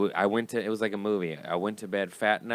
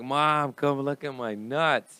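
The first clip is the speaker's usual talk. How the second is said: higher, louder, slow call to mom; 6 semitones higher, 7 dB louder, slower than usual. Retold or imitated. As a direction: shout it across the house, drawn out. Calling mom from bed.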